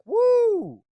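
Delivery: high, loud party whoop; hyped whoop. Retold or imitated. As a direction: whoop like a fan. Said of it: "I was like".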